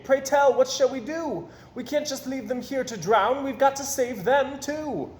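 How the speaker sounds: anxious captain pleading with Mister Payne; worried captain. A narrator's reading.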